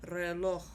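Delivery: reloj ending in a breathed H; final H sound. Shown as a demonstration. Teacher showing the J sound, reloj ending like H. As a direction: stress the O of reloj, then H. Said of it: "at the end with an H sound".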